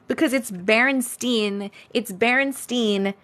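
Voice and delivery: "Berenstein" said slowly with a clear steen ending; drawn-out "Berenstein", last syllable steen; pronouncing Berenstein. Shown as a demonstration. Insisting to chat it is "Berenstein", said slowly. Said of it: "Do you believe in the Berenstein Bears?".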